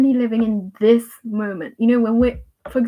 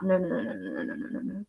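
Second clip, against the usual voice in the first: trailing-off fretful chatter; 3 semitones lower, 10 dB quieter, faster than usual. Retold or imitated. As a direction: run of no's fading to very soft. Mimics fretful worried self-talk trailing off.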